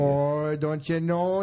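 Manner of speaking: hammed-up Minnesotan accent with long round O. Correcting his Canadian impression, Minnesotan accent. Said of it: "That's a Minnesotan there".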